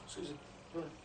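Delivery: soft rushed street approach; quiet quick pitch. Shown as a demonstration. Street preacher mimics a shy approach, softly. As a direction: mimic a hesitant stall pitch, low-key. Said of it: "like that, yeah?".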